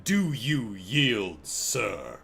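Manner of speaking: slow, solemn mock-regal challenge, dropping low at the end; imitating a very serious deep-voiced little monarch; deep, serious king. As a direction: demand surrender gravely, drawing out each word. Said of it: "deep voice who's very serious".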